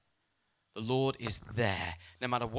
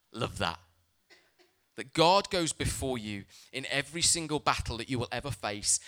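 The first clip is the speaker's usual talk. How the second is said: usual speaking voice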